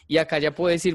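ordinary talk, nothing marked

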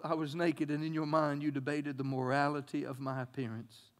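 quiet confessional reading; soft reading. Acted. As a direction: read it hushed and low. Reading written version softly.

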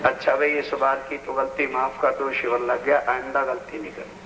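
low-pitched, subdued mock apology; माफ़ी माँगता आदमी. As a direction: lightly act out a humble plea, pitched well down. Acts out an apology for a mistake. (धीमे सुर में माफ़ी माँगने का हल्का अभिनय)

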